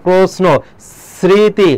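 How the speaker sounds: প্রশ্ন, স্মৃতি as 'prosno, sriti' with hissed s; ছয়ের মতো উচ্চারণ. Shown as a demonstration. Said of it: "ছয়ের মতো উচ্চারণ হচ্ছে এখানে". (প্রশ্ন, স্মৃতি-তে টানা দন্ত্য স)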